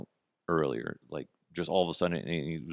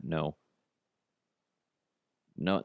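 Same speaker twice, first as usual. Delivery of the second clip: ordinary delivery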